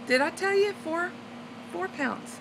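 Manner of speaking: a secret thrill shared softly; giddy whisper-soft bragging; excited. Real, not acted. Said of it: "I'm so stinking happy".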